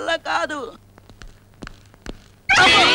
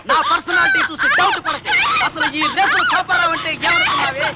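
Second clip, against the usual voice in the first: high, shouting villain boast with a laugh in it; 11 semitones higher than usual. Acted. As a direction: brag at the top of your voice. Film villain boasting while shooting.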